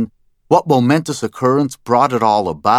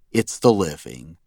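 ordinary delivery

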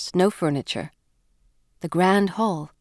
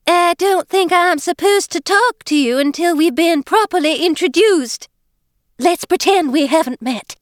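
high, overpronounced fake British voice with dropped Rs; a high, girlish put-on British act, words overpronounced; faux-British Eliza; 10 semitones higher, 4 dB louder than usual. Scene: Eliza cheerfully greets newcomer Jane, high fake-British girlish voice. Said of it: "she was attempting to sound British".

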